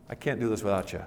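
quietly confessed dependence; humble prayer. Acted. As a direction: drop low and soft, admitting need. Voicing a humble prayer to God.

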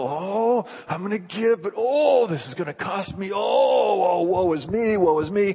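whiny, self-pitying groan, pitch jumping up on the oh; mock grudging giver. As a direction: ham up a martyr moaning over the cost. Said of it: "You don't go".

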